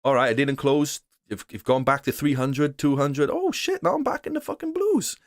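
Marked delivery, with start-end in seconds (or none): 3.3-5.2 very high relieved exclamation; relieved surprise. Retold or imitated. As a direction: squeal with relief as the trade turns green. Relieved when trade comes back.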